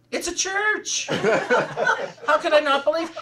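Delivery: amused retelling of her own reply; laughing. Retold or imitated. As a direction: laugh through the retort.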